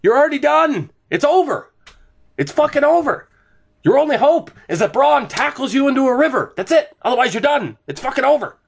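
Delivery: high-pitched, worked-up ranting; hyped rant. Real, not acted. Telling Jamie's side they are done, hyped rant. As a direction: rant it out high and insistent, no mercy. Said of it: "I scared my dog with that last rant".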